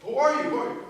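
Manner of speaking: high, loud frightened cry; panicked old man. Acted. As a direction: shriek it in terror. Terrified old man at door.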